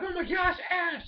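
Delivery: high, worried call after a friend; alarmed cry. Retold or imitated. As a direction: call out in alarm as he's dragged off. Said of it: "everybody's like".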